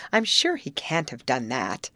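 ordinary delivery

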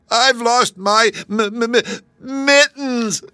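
high, slow, stammering wail; getting it out tearfully. A narrator's reading. Kangaroo finally names her loss to the travellers, wailing. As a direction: stutter through sobs, then drag out the final word. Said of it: "getting it out at last".

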